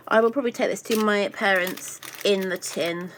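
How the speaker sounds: usual speaking voice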